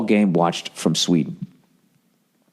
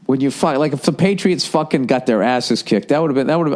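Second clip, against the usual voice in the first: ordinary delivery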